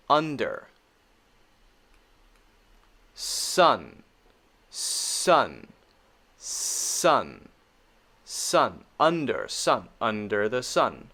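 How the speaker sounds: "Under" and "sun" with a loose "uh"; short U demo. Shown as a demonstration. Teacher drilling short U for learners, careful "Under" and "sun". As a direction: model "sun" slowly and separately, falling. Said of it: "let's try this one".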